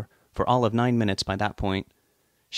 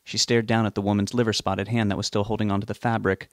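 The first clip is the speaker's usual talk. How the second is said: plain reading voice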